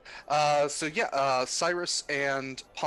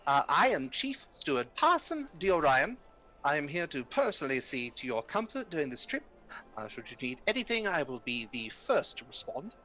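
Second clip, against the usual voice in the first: steward voice. Acted. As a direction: play a polished train steward. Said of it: "he says".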